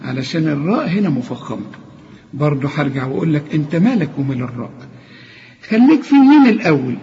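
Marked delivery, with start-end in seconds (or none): none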